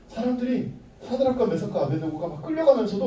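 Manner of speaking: normal talking voice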